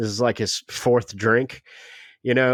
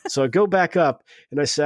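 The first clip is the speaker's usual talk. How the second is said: normal talking voice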